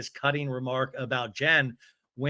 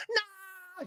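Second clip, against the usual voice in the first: very high, squealed mock no; mock yelling; 10 dB quieter than usual. [name] objects to the background check, mock high yell. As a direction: imitate a yell in a high, small voice. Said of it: "doing her default yelling".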